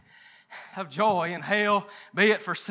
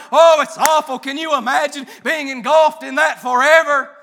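louder, shouted revival preaching; preaching at full force; 4 semitones higher, 8 dB louder than usual. Acted. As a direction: drive it home to the pews with force. Preaching to the congregation.

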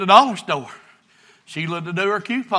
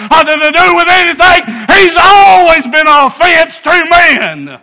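preaching at full force; 10 semitones higher, 12 dB louder than usual. Acted. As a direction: hammer it home from the pulpit. Preacher thunders at congregation.

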